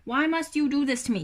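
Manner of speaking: high, loud, plaintive complaint; exasperated wail. Real, not acted. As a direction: whine loudly at a pesky pet. Complaining at the pet.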